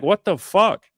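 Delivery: high, loud shocked outburst; a high, loud what-the-fuck of disbelief; outraged disbelief. Retold or imitated. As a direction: blurt it out like you can't believe it. Retold reaction to spam emails.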